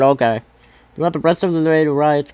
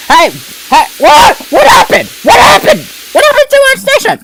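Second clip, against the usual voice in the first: shouting; 11 dB louder than usual. Real, not acted. Shouting at broadcast interruption.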